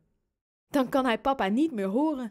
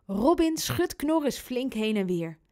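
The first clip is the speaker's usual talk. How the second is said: plain reading voice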